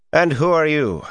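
put-on high-pitched demand in a Tarabana accent. A narrator's reading. Sneering man confronts Rand, demanding in a Tarabana accent. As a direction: challenge a newcomer in a Tarabana accent. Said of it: "he demanded in a Tarabana accent".

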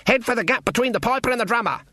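fast, high-pitched urgent order; hurried and pitched right up; urgent command. Acted. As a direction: call out directions on the run. Granny urging escape while fleeing.

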